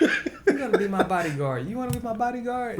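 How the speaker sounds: put-on high playful girly voice.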